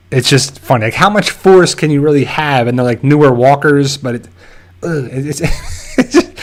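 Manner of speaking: usual speaking voice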